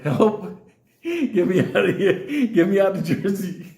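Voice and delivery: high, loud, laughing cry for help; pleading bear. Retold or imitated. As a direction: mock-desperate plea from a caged bear. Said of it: "looking at me like".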